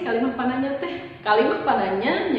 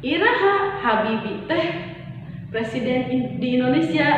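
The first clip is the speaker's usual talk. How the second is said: Iraha stressed high at the start; teacher demonstrating the question; questioning tune; 3 semitones higher than usual. Shown as a demonstration. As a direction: lift Iraha then ask the rest. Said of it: "Atau pananya waktu".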